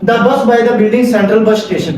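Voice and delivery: slow, clear, even delivery; one clean unbroken line; smooth in one flow. Shown as a demonstration. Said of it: "मैंने ऐसे बोला है". (एक लय में साफ़ बोलना)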